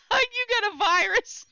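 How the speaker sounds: high, loud laughing taunt; imitated teasing laughter. Wife to husband with a computer virus, laughing at him. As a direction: crow it loudly through re-enacted laughter. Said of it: "and I'm laughing".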